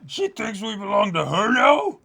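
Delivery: high, loud indignant yell; mock outraged shout.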